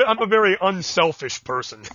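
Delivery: high, loud, through laughter; laughing self-mockery. Real, not acted. Host jokes about hogging the guest's time, laughing. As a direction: joke it out while cracking up. Joking after laughing at own rant.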